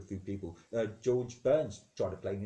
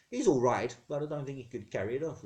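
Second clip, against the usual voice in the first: ordinary delivery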